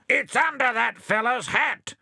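high, loud roar. Acted. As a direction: roar the accusation. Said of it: "roared Bill".

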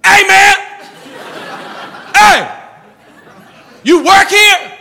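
high, loud yell at a stranger, hammed up; stranger's shout. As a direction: call out across the street, loud and high. Stranger yelling across empty street.

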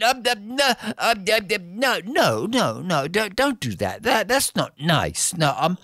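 high, stammering, backing down; a scared dragon stammering no no no; flustered dragon. Acted.

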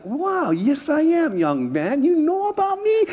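slow, swooping self-important wonder; mock flattered. Youth pastor to little boy, hammed-up flattered surprise. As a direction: puff up proudly, pitch leaping high. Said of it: "And I'm like".

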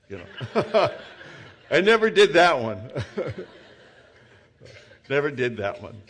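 laughing through the words; real amused laughter. Real, not acted. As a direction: chuckle as you say it. Laughs at a joke.

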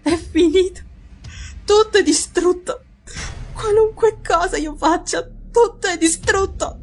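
high, slow anguished woman's voice; tormented woman, all hope gone; distraught wife. A narrator's reading. Wife despairs to Watson.

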